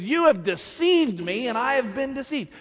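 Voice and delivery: a hint of wounded anger; bitter Jeremiah. Jeremiah complaining to God, mildly bitter. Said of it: "He says".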